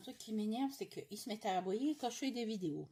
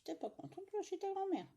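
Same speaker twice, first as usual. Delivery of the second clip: retelling a scold; 10 semitones higher, 3 dB quieter than usual. Retold or imitated. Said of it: "Ah bah, je dis".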